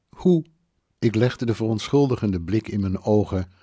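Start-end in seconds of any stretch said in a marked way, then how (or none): 0.1-0.4 a high, startled single question; stammering in shock. A narrator's reading. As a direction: blurt it out, still flustered. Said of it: "stamelde ze". (verbluft gestameld)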